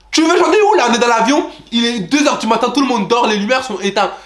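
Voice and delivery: high, loud disbelieving retort; incredulous outburst. Retold or imitated. Passenger reacting to seatmate who wants to sing, loud disbelief. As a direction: ask in shock where he'll sing.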